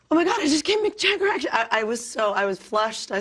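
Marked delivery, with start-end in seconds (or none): 0.1-1.5 high, fast, excited squeal; breathless fan squeal, much higher; starstruck. Retold or imitated. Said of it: "suddenly I went".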